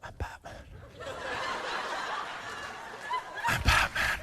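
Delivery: gravelly whispered Batman growl. Pretend. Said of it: "I'll look in the mirror and I'll say".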